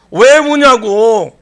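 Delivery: slow, loud, drawn-out question; angel's question again. Shown as a demonstration. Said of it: "자, 한번 다시 해". (천사처럼 느리고 크게 묻기)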